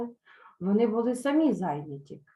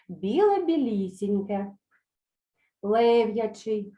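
slow word drill; slower than usual. Shown as a demonstration. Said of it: "Повторюйте за мною".

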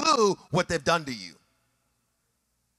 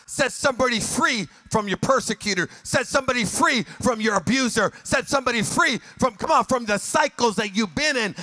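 louder, chanted preacher's cadence; preaching at full force; 4 dB louder than usual. Acted. Pastor rousing the congregation to forgive, chanted full-force preaching. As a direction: whoop it up over the organ.

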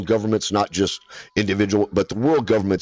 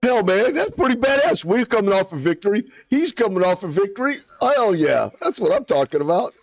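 high, hyped celebration; excited cheering; 9 semitones higher than usual. Real, not acted. Celebrating teammate's win.

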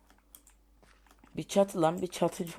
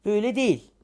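higher, louder frustrated cry; annoyed loud protest; 7 semitones higher, 10 dB louder, faster than usual. Real, not acted. Gamer crying out at a wrong block. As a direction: snap that it's wrong.

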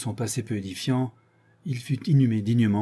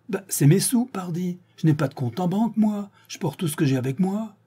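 high, loud indignant retort; indignant peasant; 9 semitones higher, 4 dB louder than usual. A narrator's reading. Séraphin retorting to the prosecutor, much higher. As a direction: scoff back at the prosecutor.

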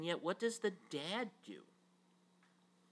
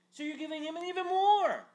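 high, loud indignant cry; indignant outburst; 14 dB louder than usual. Acted. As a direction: burst out at the unfairness. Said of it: "he answered his father".

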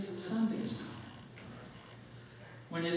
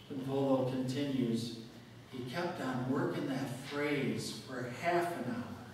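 ordinary talk

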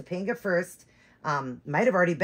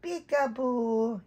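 very slow, high sing-song peekaboo; doll's peekaboo; 5 semitones higher, much slower than usual. Pretend. Mom voices the doll playing peekaboo, slow and sing-song. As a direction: draw out peekaboo like a baby's game.

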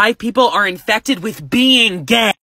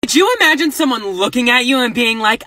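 ordinary delivery

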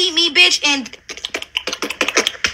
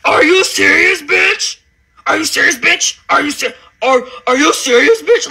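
mock screaming. Mocking what the rival kept screaming, a mild mock yell. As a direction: parrot her panicked repeat, mock shout. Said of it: "all you kept screaming was".

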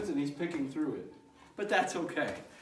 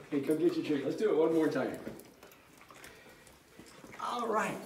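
ordinary delivery

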